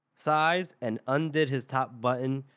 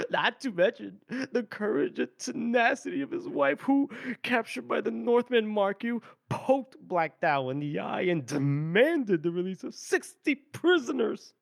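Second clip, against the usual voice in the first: higher, far-off mocking courtier voice; mock barb; 8 semitones higher than usual. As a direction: slip a snide jab into flattery. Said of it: "throw in that little bit of a barb".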